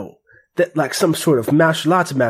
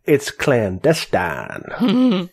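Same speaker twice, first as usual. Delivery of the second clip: drawn-out, very slow; very slow Charlie line; much slower than usual. Acted. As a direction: stretch every syllable slowly. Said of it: "Charlie speaks up and says".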